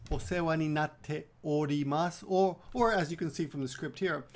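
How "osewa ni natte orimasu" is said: slow. Shown as a demonstration. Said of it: "quickly, because that's the way they say it".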